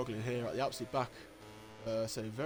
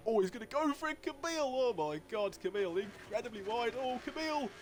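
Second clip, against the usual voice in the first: excited yell; 9 semitones higher than usual. Real, not acted. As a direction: gasp it out as he nearly loses it. Camille attempts a wild pass.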